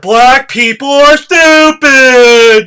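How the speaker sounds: mocking Trump paraphrase. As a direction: spell out what he really means, slowly. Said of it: "That's what he's saying".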